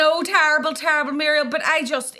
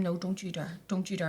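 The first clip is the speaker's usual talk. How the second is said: hushed pleading; 6 semitones lower, 11 dB quieter than usual. Retold or imitated. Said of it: "so I said to him".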